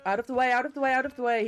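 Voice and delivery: loud, high, insistent repeats; urgent call. Real, not acted. Urging others aside during game.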